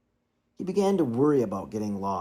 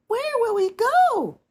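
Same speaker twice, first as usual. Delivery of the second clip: put-on very high, tiny chorus of baby swans; cygnets crying out; 7 dB louder, slower than usual. A narrator's reading. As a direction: squeak it like a startled cygnet. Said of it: "cried all the cygnets".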